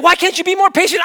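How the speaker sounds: high, loud imitation of mom arguing; mock yell. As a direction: yell it like an exasperated mother. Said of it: "we would just have this back and forth".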